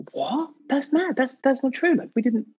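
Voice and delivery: high, startled disbelief; shocked denial. Retold or imitated.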